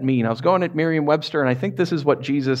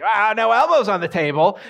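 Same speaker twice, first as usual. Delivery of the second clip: higher-pitched mock scolding; tattling sibling; 5 semitones higher, 3 dB louder than usual. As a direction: call out a sibling's rule-breaking. Child tattling on brother at table.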